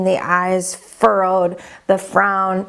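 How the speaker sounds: normal talking voice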